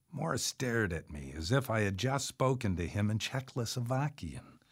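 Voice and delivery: plain reading voice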